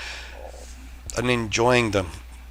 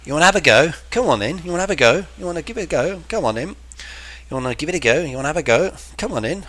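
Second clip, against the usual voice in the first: higher Australian twang, inviting; Aussie bloke calling you in, having a go; mock Aussie; 4 semitones higher, much faster than usual. Teacher imitating Aussie mate inviting chat, mock Aussie. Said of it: "that's Aussie slang".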